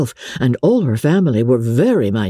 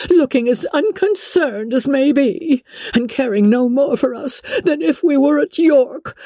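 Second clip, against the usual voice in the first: high, self-pitying matron voice; fretful mother, high and put-upon; aggrieved Mrs Bennet; 8 semitones higher than usual. A narrator's reading. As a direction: play the wronged mother of nerves. Mrs Bennet scolds Lizzie.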